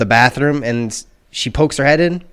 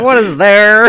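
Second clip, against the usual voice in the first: higher, louder exasperated question; mock freaked-out yell; 8 semitones higher, 9 dB louder, slower than usual. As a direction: blurt it out as if spooked by the cats. Freaked out at staring cats.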